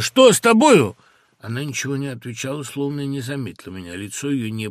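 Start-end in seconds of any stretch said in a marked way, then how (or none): 0.0-0.9 loud, high, alarmed question; shout. A narrator's reading. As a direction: cry out to her, much louder and higher. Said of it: "закричал я". (крик, громко и высоко)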